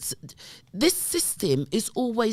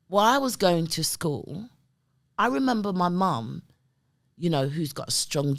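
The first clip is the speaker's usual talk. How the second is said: ordinary talk, nothing marked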